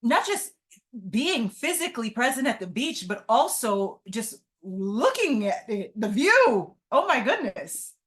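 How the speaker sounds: real exhilaration, pitch leaping up on key words; excited. Real, not acted. Guest telling the host how the beach view feels, excited. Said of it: "I'm just getting excited here".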